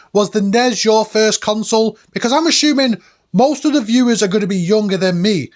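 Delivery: ordinary talk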